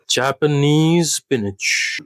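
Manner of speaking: drawn out, savoring each word of "Japanese spinach"; "Japanese spinach" stretched out slowly; slow dish announcement. Shown as a demonstration. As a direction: present "Japanese spinach" slowly like a menu item.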